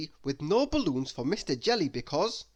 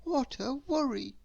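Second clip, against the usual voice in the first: anxious fretting in a much higher voice; worried muttering; 11 semitones higher than usual. A narrator's reading. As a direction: wring your hands and fret aloud. Mister Worry frets.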